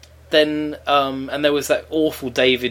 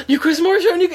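high-pitched eager gush after a gasp; gasp, then gush like a thrilled fan; re-enacted excitement, pitched way up; excited fan's thought; 4 dB louder, faster than usual. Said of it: "'cause watching that thinking".

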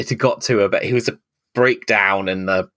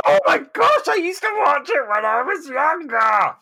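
protesting the accusation, voice shooting up; exasperated outburst. Real, not acted. Exasperated at being called a liar.

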